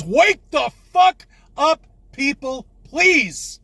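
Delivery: high, loud and slow, yelling it at the world; furious shout. Real, not acted. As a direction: bellow at everyone, pleading at the end. Ranting at sheeple on camera.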